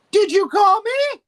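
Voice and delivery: high, loud, drawn-out eager pop-up; Baroni. Retold or imitated. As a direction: call it out high and slow, hammed-up. Voicing Baroni popping up suddenly.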